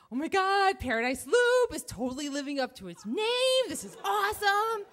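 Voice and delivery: high, thrilled gush; gushing excitement. Retold or imitated. Rider thrilled that the trail lives up to its name, gushing. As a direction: gush as if the day is perfect. Gushing about Paradise Loop.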